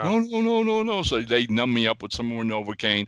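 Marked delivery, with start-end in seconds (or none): 0.0-1.0 high, rapid string of refusals; retold protest. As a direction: act out a high-pitched, frantic refusal. Acting out his panic in chair.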